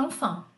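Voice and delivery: enfant com an nasal, claro; modelo para repetir. Shown as a demonstration. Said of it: "Repete comigo". (professora modela enfant)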